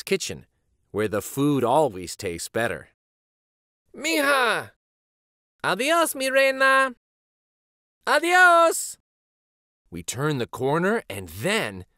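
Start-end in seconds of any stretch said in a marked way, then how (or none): 4.0-6.9 high, fond call from the porch; lightly voiced grandmother calling out; grandma calling goodbye. 8.1-9.0 high, louder farewell call; lightly voiced grandfather calling out; grandpa calling goodbye.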